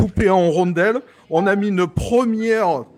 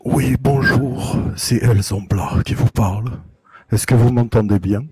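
seductive radio voice; 5 semitones lower than usual. Acted. Zomblard greets the hosts on air, deep seductive voice. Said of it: "avec cette voix".

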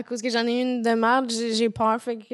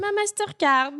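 "Mastercard" high, in a put-on English accent; 8 semitones higher than usual. Shown as a demonstration. Showing why she can't say it, mock English accent on "Mastercard". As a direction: pitch up and exaggerate an English "Mastercard". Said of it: "l'accent anglais".

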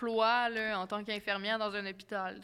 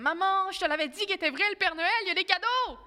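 put-on loud, high-pitched triumphant kid; excited child; 9 semitones higher, 6 dB louder than usual. Child waking mother on Christmas morning, excited shouting. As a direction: crow it like a vindicated child. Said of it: "puis je suis comme".

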